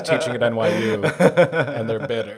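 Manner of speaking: loud laughing jab. Real, not acted. Host jokes about a bitter teacher, laughing.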